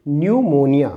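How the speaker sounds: pneumonia said as numonia, the p dropped; silent p demo. Shown as a demonstration. Teacher showing students the silent p in pneumonia. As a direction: start pneumonia straight on n. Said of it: "it is".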